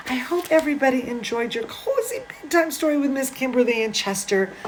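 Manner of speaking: fast, soft whisper with a laugh; hushed sign-off so the sleeping cat stays asleep. Real, not acted. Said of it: "Chester fell asleep".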